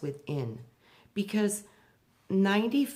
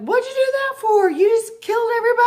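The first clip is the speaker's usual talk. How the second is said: much higher and louder, fast frantic yell; panicked yelling; 11 dB louder, faster than usual. Retold or imitated. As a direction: scream it at God in total panic. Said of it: "and I'm yelling".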